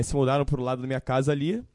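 ordinary talk

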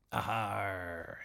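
put-on hearty stage-pirate accent. A narrator's reading. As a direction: growl it as a play-acting pirate. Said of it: "in his best pirate accent".

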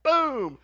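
booming high sound effect; loud impact boom. Retold or imitated. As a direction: shout out the moment of impact. Imitates the turd hitting her.

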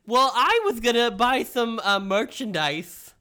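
high loud cartoon king; King Candy. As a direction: ask it eagerly in character. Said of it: "I sound like the Mad Hatter".

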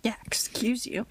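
whispered "excuse you"; teasing whisper back to the co-host. Real, not acted. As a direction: hiss it back under your breath. Whispered retort.

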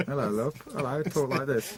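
playful showing-off voice; dubbed actor voice. Shown as a demonstration. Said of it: "That's me".

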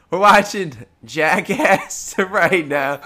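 words through real laughter, slowed; laughing while watching Jackass. Real, not acted. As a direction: giggle the words out.

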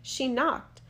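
plain reading voice

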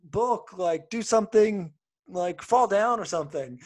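lightly voiced excited kids; kids calling out. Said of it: "they're like".

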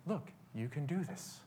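very soft fatherly coaxing; retold quiet encouragement. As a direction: encourage a scared child in a hush. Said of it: "I remember my dad then saying".

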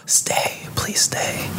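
unvoiced, pleading whisper; whispered plea. Acted whispered aside, opposite plea.

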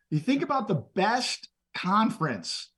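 normal talking voice

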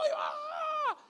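high breathy gasp of alarm; a sudden high alarmed cry; panicked gasp; mock panic. Panic at end of world.

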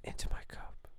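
soft breathy whispering into the mic; ASMR whisper. Pretend. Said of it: "Hold on, ready?".

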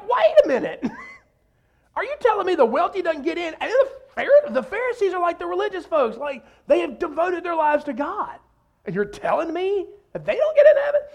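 high-pitched incredulous protest; comic astonished objection. Acted. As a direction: sputter in hammed-up disbelief, voice way up high. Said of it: "the disciples were astonished".

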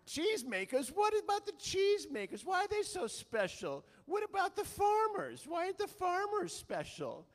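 put-on indignant high shout in an amateur English accent; hammed-up Monty Python heckler with English accent; shrieked English-accented protest, comically outraged; mock English accent. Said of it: "I don't do the English accent very well".

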